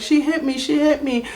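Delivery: high-pitched mock crying protest; retold tearful complaint. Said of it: "and I'm crying".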